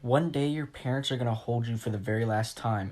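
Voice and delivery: ordinary talk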